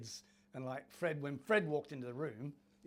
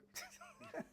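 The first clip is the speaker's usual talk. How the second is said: high, squeaky disbelieving laugh; a real giggle of disbelief; burst of laughter; 13 dB quieter than usual. Real, not acted. Laughing at being picked.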